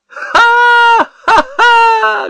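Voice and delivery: loud laugh. A narrator's reading. Husband laughs hysterically at wife. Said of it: "громко рассмеялся Ермолкин".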